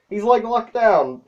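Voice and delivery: high, slow, amused delight; tickled by the joke, drawn out. Real, not acted. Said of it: "I found this hilarious".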